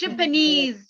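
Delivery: "Japanese" stressed on NESE; stress on third syllable. Shown as a demonstration. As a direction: land on a long final NESE in "Japanese". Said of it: "in the third one".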